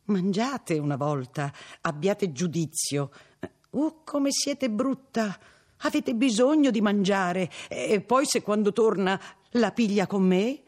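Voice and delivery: put-on high, slow, peevish begging old woman; old servant coaxing the girl, petulant and pleading. A narrator's reading. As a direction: nag her to eat, whining and irritated. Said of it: "con un tono di supplicazione stizzosa".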